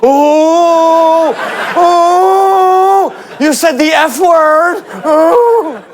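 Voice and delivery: high, drawn-out tattling oohs; hammed-up playground tattletale shock; mock scandalized. Crowd pounces on a celebrity's swear word, mock scandalized.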